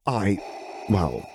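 halting, hesitant start; falter, leaving a gap between the words. A narrator's reading. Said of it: "he faltered".

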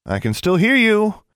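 high, loud, indignant protest; indignant call. A narrator's reading. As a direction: call out so the whisperers hear you. Alexandra interrupts the whispering, indignant.

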